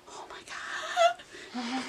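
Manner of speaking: tiny high whisper, slow. Acted. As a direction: squeak it out in a whisper. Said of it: "I turned to Steven and whispered".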